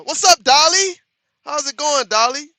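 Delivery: high, loud, happy hello; excited greeting. Real, not acted. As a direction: greet the viewer with a burst of joy. Greeting a viewer by name.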